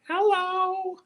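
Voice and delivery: very high squeaky pet voice; cat voice. Acted. Comic voicing the cat, very high and squeaky.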